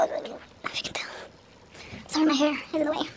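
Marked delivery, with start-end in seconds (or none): 0.0-1.1 hushed whisper, barely voiced. Real, not acted. Vlogger concentrating during pull, whispering to viewers. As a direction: breathe the words under your breath. Quiet focused moment, whispering.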